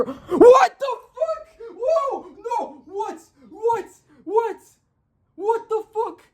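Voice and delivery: very high, squealed disbelief; shocked disbelief. Real, not acted. Reader hit by father reveal, shrieking in disbelief.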